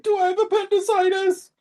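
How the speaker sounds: high, put-on patient voice; mock Alex. Alex asking the doctor, in a high mock voice. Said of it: "Alex is like".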